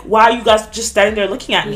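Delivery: retold anger. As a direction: re-enact calling out the bystanders, annoyed. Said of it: "I was mad at all the men".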